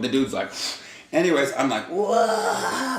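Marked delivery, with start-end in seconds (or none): 1.8-3.0 whoa. Retold or imitated. Jumping in to break up fight.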